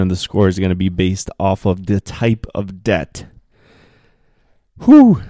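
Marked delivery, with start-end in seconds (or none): 4.8-5.2 loud, high whew; big whew to catch your breath; exhaled whew. Real, not acted. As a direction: let out a sharp, high whew. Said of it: "Let me take a breath there".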